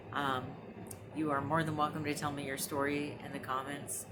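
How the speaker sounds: ordinary talk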